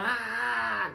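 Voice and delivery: high, long-held holler. Retold or imitated. Inmate making noise so victim can't talk, hollering. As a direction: keep up a high wordless yell. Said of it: "Start hollering".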